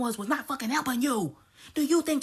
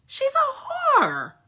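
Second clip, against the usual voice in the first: higher, louder, slow stretched yell; slow shout; 12 semitones higher, 6 dB louder, much slower than usual. Real, not acted. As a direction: drag it out in a shout. Ranting outburst.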